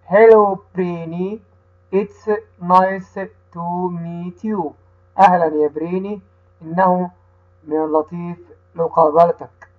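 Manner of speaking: slow, careful reading with each word set apart for the class to repeat; teacher reads the dialogue slowly so the children can repeat; slow read-aloud for repeating. Shown as a demonstration. Teacher reading slowly to children who repeat after her. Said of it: "هقرأها أنا وهتقول ورايا".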